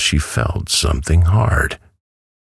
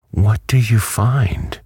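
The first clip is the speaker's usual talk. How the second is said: curious, high-pitched question; 10 semitones higher than usual. A narrator's reading. Dorothy asks the pecking hen about her food, curious. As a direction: ask with lifted, inquisitive interest. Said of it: "inquired the girl curiously".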